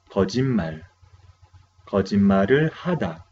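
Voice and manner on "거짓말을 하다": quick teacher model of 거짓말을 하다; 거짓말을 하다 said briskly as a model; fast model reading. Shown as a demonstration. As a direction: rattle off 거짓말을 하다 at speed.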